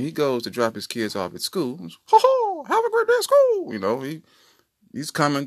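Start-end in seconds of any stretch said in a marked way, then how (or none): 1.8-3.7 very high, loud falsetto holler; hearty dad send-off. Acted. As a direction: call out a hammed-up goodbye to the kids. Brother voiced at school drop-off.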